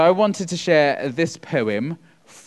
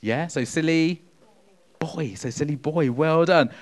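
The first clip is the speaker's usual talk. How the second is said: ordinary talk, nothing marked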